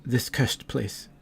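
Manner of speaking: a lightly voiced stricken dryad, hushed at first; shaken dryad. Dryad realizing her past deeds to the party, hushed and shaken.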